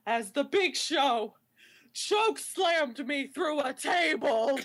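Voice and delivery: slow, portentous wrestling promo with long pauses; mock-solemn Randy Orton promo; deadly serious wrestler. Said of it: "he's, like, all serious".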